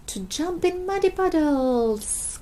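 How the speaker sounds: put-on high, slow, gleeful child cry; excited cry. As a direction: drag it out with joy, dreaming of puddles. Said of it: "cried Peppa".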